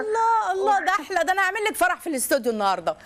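high, happy burst of joy; delighted. Real, not acted. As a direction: cheer the caller's good news. Host delighted by caller's news.

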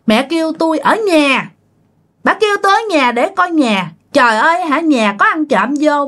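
high-pitched, self-important ghost voice; boastful spirit. Retold or imitated. Spirit tells exorcist of shoving thief and brother, high and boastful. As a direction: boast of mischief in a high character voice.

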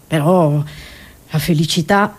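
ordinary delivery

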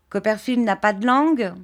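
usual speaking voice

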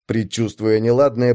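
usual speaking voice

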